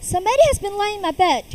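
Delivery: a lightly voiced middle bear. A narrator's reading. Mother bear finds her bed disturbed, lightly voiced. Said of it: "in his middle voice".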